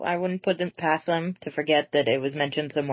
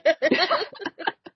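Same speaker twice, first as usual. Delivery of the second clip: high laugh; 12 semitones higher than usual. Real, not acted. Laughing at the joke.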